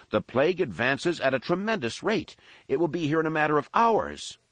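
lightly voiced panicked man; desperate Jerem. A narrator's reading. Jerem urgent voice.

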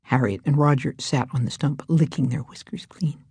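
ordinary delivery

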